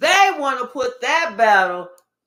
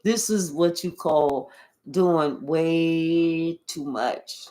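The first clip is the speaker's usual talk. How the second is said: slow emphasis; 6 semitones lower, much slower than usual. Real, not acted.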